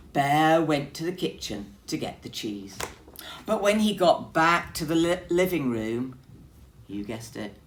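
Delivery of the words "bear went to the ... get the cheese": plain reading voice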